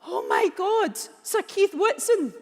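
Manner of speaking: it's just hit you; sudden realisation shout. Retold or imitated. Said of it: "Until I shouted".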